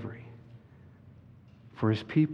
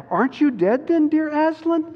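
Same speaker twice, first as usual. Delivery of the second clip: put-on high, raised girl's voice; child character torn between fear and joy; frightened and glad Lucy; 4 dB louder than usual. A narrator's reading. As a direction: ask in amazed, high-pitched disbelief. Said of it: "almost as much frightened as they were glad".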